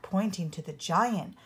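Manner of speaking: plain reading voice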